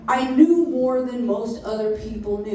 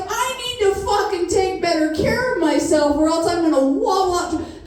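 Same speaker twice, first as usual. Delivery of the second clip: higher voice with a laugh, vowing to change; laughing, pitched-up resolve; 7 semitones higher than usual. Retold or imitated. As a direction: talk to yourself, higher and amused. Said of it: "So I was like".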